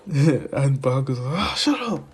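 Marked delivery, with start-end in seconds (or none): 1.4-2.0 high-pitched mock outburst; angry high yell. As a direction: yell it back in a fit. Baku snapping in quarrel.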